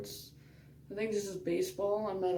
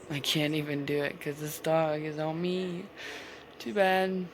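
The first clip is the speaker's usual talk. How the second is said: ordinary talk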